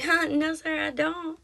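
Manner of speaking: a light imitation of a confused receptionist; polite puzzled. Receptionist answering the rude old man, lightly mimed puzzled politeness. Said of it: "she's like".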